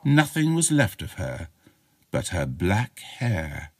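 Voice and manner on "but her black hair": plain reading voice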